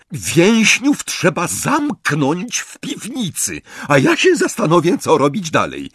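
high-pitched, forceful instruction; commanding order. A narrator's reading. Bellatrix orders sister to lock up prisoners, commanding. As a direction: lay down orders firmly.

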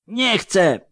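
high, terrified cry of refusal; panicked scream. Acted. Wounded man screaming at the surgeon's knife. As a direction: scream it out in terror. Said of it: "rozdarł się ranny".

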